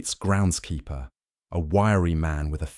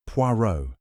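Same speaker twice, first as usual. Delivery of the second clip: ordinary delivery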